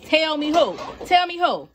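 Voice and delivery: rising, teasing dare; playful challenge. Real, not acted. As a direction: dare anyone to top it. Challenging anyone to beat her.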